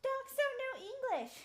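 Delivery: mocking doubters. Streamer mocking people who doubt her dog, high slow sneer. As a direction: parody a know-it-all skeptic. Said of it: "Before people are like".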